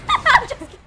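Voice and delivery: high, loud laughing retraction. Real, not acted. Friend taking back a joke, laughing loudly. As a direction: burst out laughing through the words.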